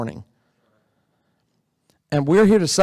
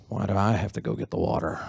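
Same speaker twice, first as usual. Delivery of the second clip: grumbling under the breath, lower and softer; under his breath; 8 semitones lower, 6 dB quieter, faster than usual. Retold or imitated. Pastor showing murmuring as Dimitri complains, under his breath. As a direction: mutter the complaint so nobody hears. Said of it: "under his breath he goes".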